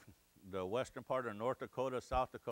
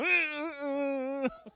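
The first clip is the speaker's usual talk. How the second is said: wheezing laugh; 6 dB louder than usual. Retold or imitated. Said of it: "and I'd go".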